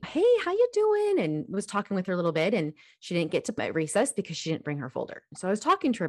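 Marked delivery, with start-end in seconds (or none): 0.1-1.2 much higher, bright sing-song hello; cheery greeting. Retold or imitated. Parent greets her little daughter, cheerfully high. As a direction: greet the child lightly and cheerfully. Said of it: "came out of my office and I".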